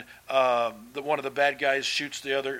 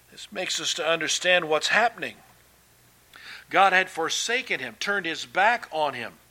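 ordinary talk

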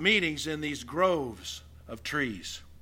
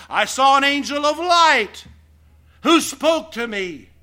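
proud claim; 10 semitones higher, 10 dB louder than usual. Retold or imitated. Boasting of a vision.

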